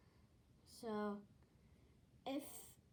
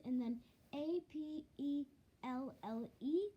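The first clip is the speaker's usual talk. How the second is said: usual speaking voice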